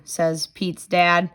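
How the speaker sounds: usual speaking voice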